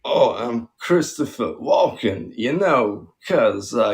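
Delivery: halting celebrity impression with odd pauses; Christopher Walken. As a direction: break the lines up in an actor's quirky cadence. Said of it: "do this one impression".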